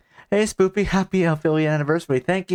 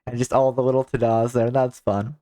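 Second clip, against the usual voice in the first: usual speaking voice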